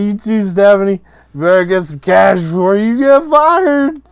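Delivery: high, loud, slow mocking tirade; drunken rant. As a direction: sneer at each relative in turn. Drunk [name]'s rant at family.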